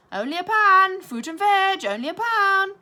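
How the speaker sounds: loud high street-trader cry; market shout. Acted. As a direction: bellow it across the street at passers-by. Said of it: "who like to shout".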